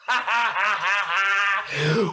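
low, rhythmic ha-ha-ha; deep preacher's laugh; low chuckling laugh. Real, not acted. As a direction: laugh in a long, low staccato run. Preacher's own laugh mid-sermon.

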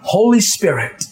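a pastor praying low and hushed over his people; fervent prayer. Acted. Leads the congregation in prayer.

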